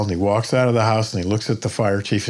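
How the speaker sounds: ordinary talk, nothing marked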